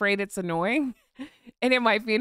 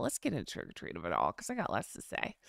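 much lower and softer, dragged down in a creaky sigh of annoyance; vocal eye roll; 13 semitones lower, 9 dB quieter than usual. Shown as a demonstration. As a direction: roll your eyes with your voice, sink low and go quiet. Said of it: "I'm rolling my eyes vocally".

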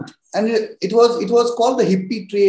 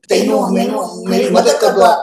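louder, hammed-up Punjabi plea; Punjabi accent; 4 dB louder than usual. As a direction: ham up the Punjabi accent asking for help. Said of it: "Your impression of Punjabi people is very funny".

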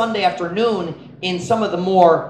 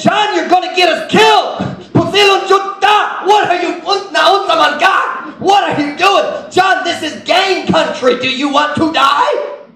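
high, loud frantic shouts; mock panicked shout, high and loud; panicked yelling; 11 semitones higher, 5 dB louder than usual. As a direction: yell in panic to stop a friend.

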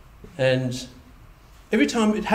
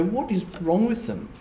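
exasperated; 6 semitones higher, 4 dB quieter than usual. Retold or imitated. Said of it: "we look at these people and go".

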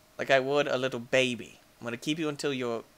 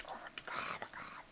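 stunned breathy whisper; 19 dB quieter than usual. Real, not acted. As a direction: barely breathe it in shock. Aftermath of pet death.

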